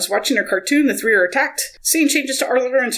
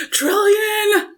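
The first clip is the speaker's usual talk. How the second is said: high-pitched drawn-out name call; mock yell; 13 semitones higher than usual. Arthur calls for captured Trillian, high mock yell. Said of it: "Arthur yells".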